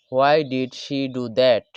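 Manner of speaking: each word spoken slowly and clearly for learners; teacher modelling a question slowly; slow, careful English. Shown as a demonstration. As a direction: lay out every word so students can copy it. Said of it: "এইভাবে।".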